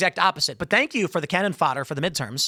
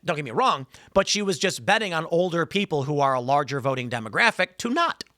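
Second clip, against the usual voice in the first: ordinary talk, nothing marked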